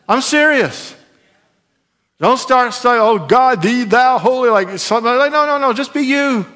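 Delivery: high-pitched, insistent preacher voice; emphatic preaching. Acted. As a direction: drive it home from the pulpit. Preaches with force.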